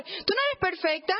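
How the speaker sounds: ordinary delivery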